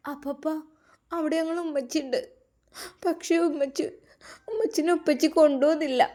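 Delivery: put-on whimpering child. A narrator's reading. Little girl telling uncle her mother was left behind, whimpering. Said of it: "വിതുമ്പിക്കരഞ്ഞ്".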